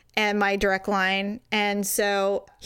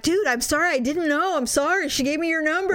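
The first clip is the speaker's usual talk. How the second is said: higher-pitched, pleading excuse; flustered retold apology; 6 semitones higher than usual. Caller apologizing to the angry boyfriend, mock flustered. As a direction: imitate a guy hastily backing off.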